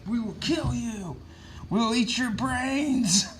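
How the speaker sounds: high, slow, otherworldly monster voice; monster threat. Acted. The Venom suit threatens the Red Goblin in a slow, high monster voice. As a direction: drag out the threat like a hungry symbiote.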